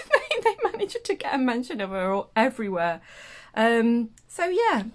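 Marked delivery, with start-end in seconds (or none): 0.1-3.0 real laugh breaking into the words; high, giggly voice talking through laughter. Real, not acted. Vlogger amused at founder mentions everywhere, laughing as she talks.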